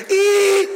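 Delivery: very high squeal held on one note; translator's long eee. Retold or imitated. Translator keeping up with the preacher, long high squealed eee. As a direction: imitate an endlessly stretched emphasis sound. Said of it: "he goes".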